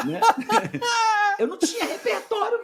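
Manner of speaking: high, loud burst of laughter through words; laughing at how lost he was; laughing hard. Real, not acted. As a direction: laugh at yourself. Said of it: "laughs at own story".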